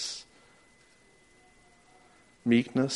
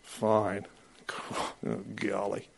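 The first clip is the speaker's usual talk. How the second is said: sarcastic; 2 semitones lower, 8 dB quieter, much slower than usual. Retold or imitated. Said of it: "we don't go".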